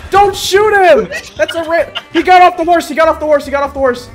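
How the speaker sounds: high, loud, urgent protest; frantic shout. Real, not acted. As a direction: yell to hold fire, frantically. Frantic stopping of teammate.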